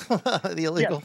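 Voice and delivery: slow, amused repeat through a laugh; host laughing at caller's word. Real, not acted. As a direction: chuckle it back in disbelief.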